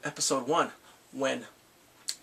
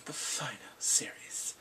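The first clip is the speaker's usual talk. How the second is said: dramatic whisper; 4 semitones lower, 7 dB quieter than usual. Pretend. Dramatic hushed aside.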